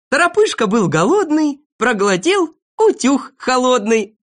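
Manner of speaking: high, loud, measured declamation; reciting a verse. Acted. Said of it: "послушайте про Торопышку". (громко декламирует стишок)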